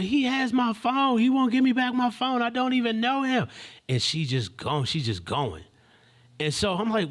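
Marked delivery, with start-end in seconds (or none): 0.0-3.5 high, loud, frantic complaint; imitated hysterics. Young woman telling bystander about her phone, imitated hysterics. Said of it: "She's in hysterics".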